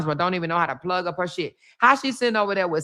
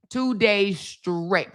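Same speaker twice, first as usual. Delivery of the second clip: slow emphatic; much slower than usual. Acted. Slowed for emphasis.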